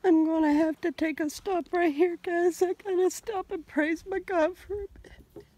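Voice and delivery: really moved and trying to hold it together; tearful, breaking up; overcome with emotion. Real, not acted. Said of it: "get a little drippy".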